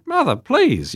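high, loud, exasperated plea; telling mother off. Retold or imitated. Son telling off mother on the phone, exasperated. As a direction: imitate an exasperated son's protest. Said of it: "And I told her off".

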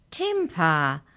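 cheerful little chiding. A narrator's reading. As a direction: chide lightly, with good cheer. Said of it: "said the mouse cheerfully".

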